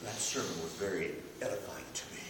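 quick, soft prim church voice; pious churchgoer. Retold or imitated. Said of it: "people who talk like".

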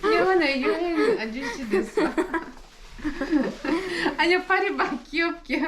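high-pitched, giggling through the words; laughing while talking. Real, not acted. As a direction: talk while really cracking up. Cracking up at the dog. (웃음이 터진 채 높은 목소리로)

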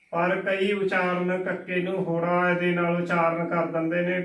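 ordinary talk, nothing marked

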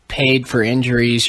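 usual speaking voice

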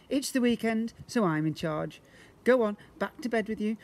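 a hint of a bossy father; taking charge. A narrator's reading. Father sends his wife back to bed, hearty.

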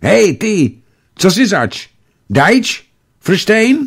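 put-on high, urgent boy calling out; excited kid challenging a stranger, pitched well up; excited child's voice. A narrator's reading. A boy in the bushes challenges a stranger, excited child's voice. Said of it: "vzrušený dětský hlas".

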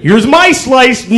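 lightly voiced cocky grandfather; mock bragging grandfather; smug grandfather. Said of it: "He goes".

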